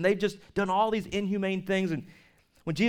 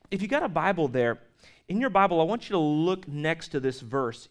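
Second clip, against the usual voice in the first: usual speaking voice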